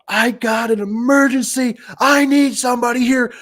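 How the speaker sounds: high, loud, slow mock scream of panic; imitating a screaming customer. Frantic customer screaming at the door repairman for help, high mock shout. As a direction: shriek the demand like a frantic caller. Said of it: "just full out screaming".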